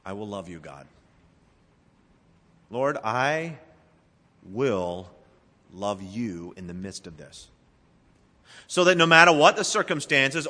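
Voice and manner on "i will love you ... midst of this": soft prayer. Shown as a demonstration. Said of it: "the first thing you prayed was".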